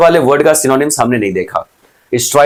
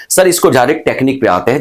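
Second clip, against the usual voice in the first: ordinary delivery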